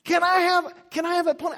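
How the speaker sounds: put-on high pleading kid voice; begging child. Child begging parents for a pony, high and pleading. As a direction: whine it like a child wanting a pony. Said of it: "Imagine your child comes in and says".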